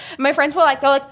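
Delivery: ordinary delivery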